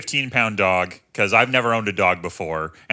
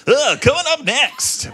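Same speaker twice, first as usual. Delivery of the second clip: high, hammed-up radio announcer voice; 13 semitones higher than usual. Shown as a demonstration. Student showing off his radio voice, hammed-up announcer. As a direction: play the radio host. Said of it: "I got this sweet-ass voice".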